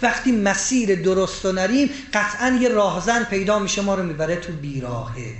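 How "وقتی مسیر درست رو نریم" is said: ordinary talk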